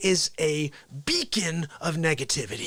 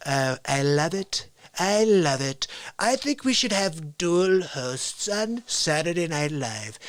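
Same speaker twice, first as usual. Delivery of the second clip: slow, drawn-out presidential impression; self-satisfied politician hosting a show; Donald Trump; slower than usual. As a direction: announce it ponderously, phrase by phrase. Plays Donald Trump as co-host.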